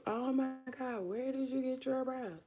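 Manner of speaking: gushing fan. Retold or imitated. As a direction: coo it like an admirer. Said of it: "I was about to tell y'all".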